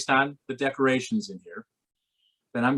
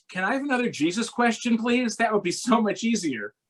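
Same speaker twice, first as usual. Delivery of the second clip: higher comic plea; pleading rabbi; 10 semitones higher than usual. Retold or imitated. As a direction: beg for an easier question.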